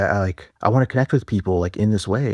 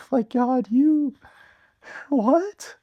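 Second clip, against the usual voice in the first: very high, squeaky words through laughter; laughing disbelief; much slower than usual. Real, not acted. Reacting to God's answer, helpless high-pitched laughter. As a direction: break up laughing, voice climbing right up.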